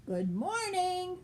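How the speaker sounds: high, slow, courteous hello; a proud little duck greeting a stranger politely. Acted. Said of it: "quacked Mister Mallard, being polite".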